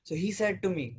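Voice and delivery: usual speaking voice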